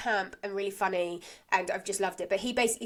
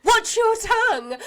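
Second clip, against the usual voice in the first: high, loud, drawn-out mock telling-off; mock angry rebuke; 11 semitones higher, 8 dB louder, slower than usual. Landlord scolding after the Rovers outburst, mock angry. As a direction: imitate an outraged landlord, slow and shouted.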